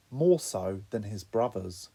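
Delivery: ordinary delivery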